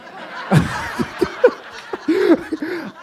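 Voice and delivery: breathy burst of laughter at his own joke; real laugh. Real, not acted. Preacher laughing at his own joke, real laugh. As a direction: let the laugh spill out.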